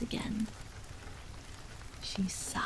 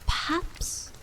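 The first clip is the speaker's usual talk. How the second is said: whispered; 5 semitones higher, slower than usual. A narrator's reading. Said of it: "she whispered".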